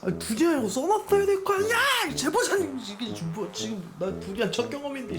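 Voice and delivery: high-pitched indignant outburst; outraged complaint. Real, not acted. Complaining to the sender about the durian candy, outraged and high. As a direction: rail at whoever sent it.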